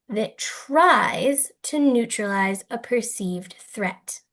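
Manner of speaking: "tries" punched, the whole line slowed; stress on tries. Shown as a demonstration. Teacher defining the term to students, stressing "tries". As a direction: lean hard on "tries" and take it slowly. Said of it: "how much I emphasize the word tries".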